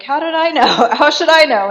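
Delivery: high, loud mock protest; hammed-up indignant cry of self-defence; exasperated protest. Wife defending herself to raging Marquis, loudly exasperated in retelling.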